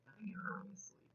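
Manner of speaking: fast hushed whisper to a sleeper; a soft, quick bedside whisper. Acted. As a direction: breathe it so you won't wake them. Talking to a sleeping listener.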